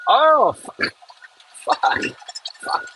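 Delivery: sudden high startled swear; startled curse. Real, not acted. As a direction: caught off guard as the machine lurches. Machine slips on bumpy ground.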